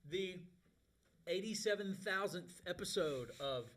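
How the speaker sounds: slow, drawn-out, high show intro; announcer voice. Acted. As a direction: stretch it like a radio host. Show host opening announcement.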